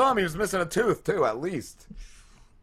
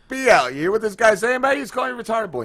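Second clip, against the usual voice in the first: higher, louder egging on; mock stirring up; 7 semitones higher, 5 dB louder, faster than usual. Teller egging coworker on against the bread guy, higher and louder. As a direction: wind him up to start a fight.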